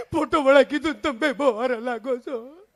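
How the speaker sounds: hammed-up sobbing aunt; mock crying. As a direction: wail it out slowly through fake sobs. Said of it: "મારી કાકી રોવે".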